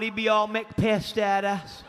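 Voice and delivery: usual speaking voice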